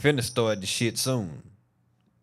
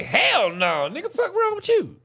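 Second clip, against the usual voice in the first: squealing mock indignation; mock outraged answer. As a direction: fire it back like the question is insulting. Mock answer to lawyer's question.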